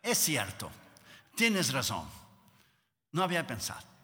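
low, soft, thoughtful concession; acted pondering, softly and in short phrases; God reconsidering. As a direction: concede quietly, as if just realizing. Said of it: "Y Dios paró y pensó".

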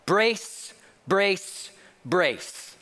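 acted crash-landing order; stewardess emergency call. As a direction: drill it out like trained cabin crew. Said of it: "what the stewardesses are trained to say".

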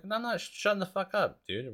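high-pitched retold protest; mock-indignant, high comeback; defiant refusal.

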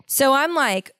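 usual speaking voice

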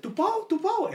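high-pitched mom calling out; imitating mom. Mom calls her fleeing son, high imitation. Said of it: "And she's like".

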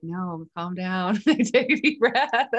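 slow, laughing mock calm-down; slow amused self-soothing with a laugh breaking through; laughing self-talk. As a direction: talk yourself down while giggling.

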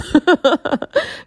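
high squeaky burst of laughter. Real, not acted. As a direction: let out an ugly high laugh. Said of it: "most disgusting laugh".

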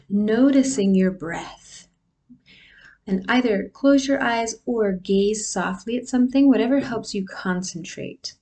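guided meditation. Acted. Leads a breathing meditation.

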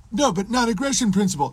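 high-pitched mock whine; mock protest. Captive protesting at the men with whips, mocked high voice. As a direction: plead the objection in a put-on high voice.

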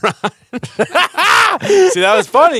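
laughing mock praise; high laughing jab. Real, not acted.

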